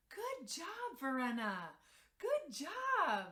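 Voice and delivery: put-on high, loud, sing-song little girl cheering herself on; proud toddler voice. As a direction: squeal it like a three-year-old proud of herself. Toddler praising herself on potty.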